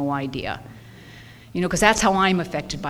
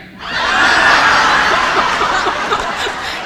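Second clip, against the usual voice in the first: high, loud whooping laugh that rises; shrieking laugh; 7 dB louder than usual. Real, not acted. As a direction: burst into a high squealing laugh. Strangers say they've been there.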